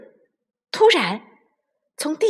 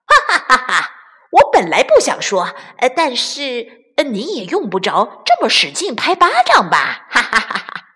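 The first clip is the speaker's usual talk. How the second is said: loud laughing tease; 2 semitones higher, 3 dB louder than usual. Acted. Bigmouth laughing while teasing the weasel. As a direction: laugh through the jibe. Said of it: "大嗓门笑着说".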